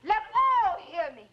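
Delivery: high-pitched, impassioned shouting; defiant shouting. Acted. Widow wanting all to hear her, defiant shouting. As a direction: shout it so the whole house hears. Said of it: "Stop your shouting, woman".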